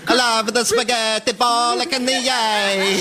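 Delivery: high, very slow hammed-up Italian; over-the-top Italian restaurant order; mock Italian accent. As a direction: draw out bolognese like a cartoon Italian. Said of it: "the Italian".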